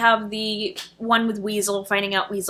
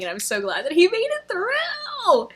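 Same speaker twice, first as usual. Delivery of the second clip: higher, laughing through tears; relieved laugh-cry; 9 semitones higher than usual. Real, not acted. Glad her favorite survived, laughing and teary. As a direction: gush with tearful relief.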